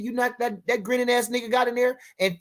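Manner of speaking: ordinary talk, nothing marked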